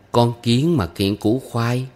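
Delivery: slow adult recitation of a folk rhyme; measured teacher's model line; reciting to teach. A narrator's reading. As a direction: give the line slowly for a child to repeat. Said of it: "Mỗi câu tôi đọc lên".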